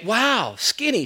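high, surprised imitation of the congregation; a playful wow from the crowd; mock wow. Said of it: "you look at me now and you say".

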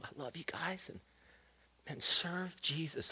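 hushed, emotional last words; tender goodbye. Retold or imitated. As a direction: say goodbye to your children. Last words to his kids.

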